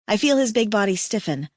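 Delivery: plain reading voice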